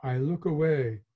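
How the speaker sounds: plain reading voice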